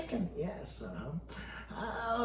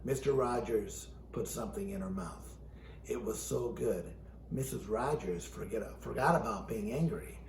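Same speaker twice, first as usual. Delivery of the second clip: quiet; 9 semitones lower, 8 dB quieter than usual. A narrator's reading. Soft low narration near end.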